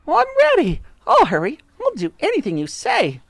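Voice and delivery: high, frantic, eager pleading; wife crying out. A narrator's reading. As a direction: promise anything in a high cry. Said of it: "cried Miss Twit".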